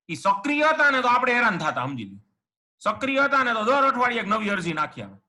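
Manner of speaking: ordinary talk, nothing marked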